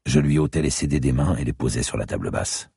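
ordinary delivery, nothing marked

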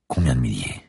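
fearful whisper; whispered dread. Acted. Narrator wondering how many parasites inside him, whispering. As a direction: breathe the question in dread.

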